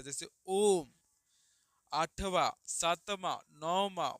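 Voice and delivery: ओम and आठवा stretched long and loud; triple-length vowel demo. Shown as a demonstration. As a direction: hold each vowel three beats, called out. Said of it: "त्रिमात्रिक स्वर". (ओम को त्रिमात्रिक लंबा खींचकर)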